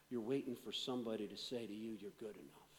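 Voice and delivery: low, soft pastoral voice; hushed, tender close of a sermon; intimate soft preaching. Real, not acted. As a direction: speak gently to each hurting listener. Soft intimate closing appeal.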